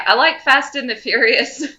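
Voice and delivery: ordinary talk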